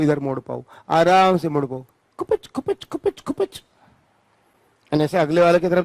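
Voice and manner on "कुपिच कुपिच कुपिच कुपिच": a very high, soft, squeaky "कुपिच कुपिच" like a joint creaking; squeaky twisting noise. Shown as a demonstration. Teacher to class, showing the wrist turning, with a very high, soft squeak. Imitated twisting sound effect.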